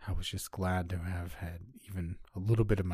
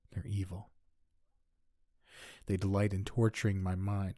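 ordinary delivery, nothing marked

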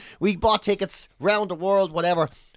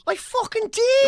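indignant insistence; slower than usual. Real, not acted. Friend insisting he told the story, indignant. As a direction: insist you did, voice shooting right up.

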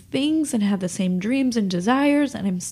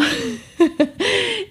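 embarrassed laugh; 12 semitones higher than usual. Real, not acted. Laughs at her own voice.

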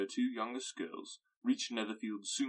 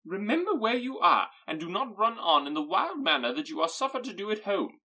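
high, loud reproach; a shrill public telling-off; scolding mother; 10 semitones higher, 10 dB louder than usual. Acted.

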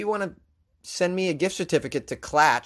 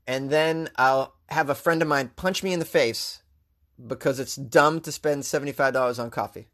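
ordinary talk

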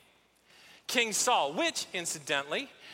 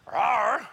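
a playful mock bear roar, louder; 4 dB louder than usual. Pastor picturing the bear for the congregation, mock roar. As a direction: growl it like a cartoon bear. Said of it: "See it, picture it".

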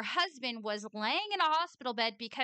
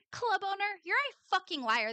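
pitched-up accusation aimed straight at him; fed-up callout of the club owner; angry callout; 8 semitones higher than usual. Real, not acted. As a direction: call him a liar to his face. Calling out the club owner directly.

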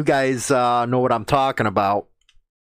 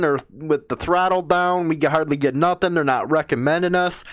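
ordinary talk

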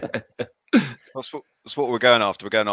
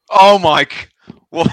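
surprised; 10 semitones higher, 12 dB louder than usual. Real, not acted. Shock at guessed player.

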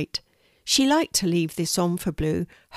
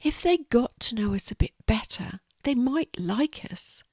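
hope shyly, slowing at the end; small, wistful creature voice; wistful spider; 5 semitones higher than usual. Acted. Spider hopes to be liked.

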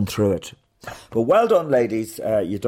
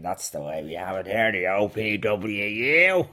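put-on Dublin accent. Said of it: "why they talk in Dublin accents".

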